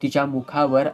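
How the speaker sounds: ordinary delivery, nothing marked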